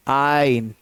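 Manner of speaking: आयन said "eye-n", R barely there; correct pronunciation. Shown as a demonstration. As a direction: let the R vanish in आयन, eye-n. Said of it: "बहुत हल्की सी आर".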